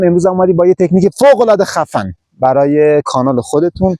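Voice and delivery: usual speaking voice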